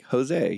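Jose said the English way, ho-ZAY; anglicized name. Retold or imitated. Explaining his name to Utah locals, Jose anglicized as ho-ZAY. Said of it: "like, 'No way, Jose,'".

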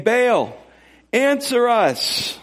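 high, long drawn-out call; mock plea to a god, stretched high; calling on Baal. Prophets calling on their god from morning till noon, high drawn-out call. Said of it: "called on the name of Baal".

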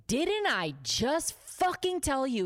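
high, slow emphatic scolding; berating boss. Retold or imitated. Boss scolding waitress over warm beer, imitated anger. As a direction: imitate a boss seething word by word.

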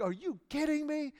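high-pitched incredulous dog voice; thrilled dogs. Acted. Dogs answer offer of a treat, acted thrilled disbelief. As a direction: play an overjoyed dog in squeaky-high disbelief.

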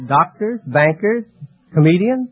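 usual speaking voice

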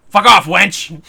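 loud, high barked shout; gruff shout. Acted. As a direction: shout her down, rough and rude. Puritan man snarls at the wife.

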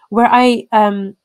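normal talking voice

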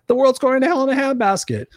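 high-pitched mock doom; high, exaggerated worrier imitation; alarmist news-watcher. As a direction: imitate a fretting news addict, voice pushed way up. Mimicking people who watch the news.

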